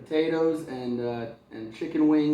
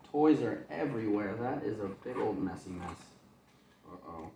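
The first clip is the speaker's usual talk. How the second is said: plain reading voice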